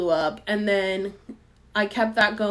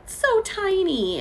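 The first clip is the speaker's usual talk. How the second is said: much higher and slow on "so tiny"; 12 semitones higher, much slower than usual. Retold or imitated. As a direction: stretch "so tiny" up high and slow to sound small. Describing herself very small.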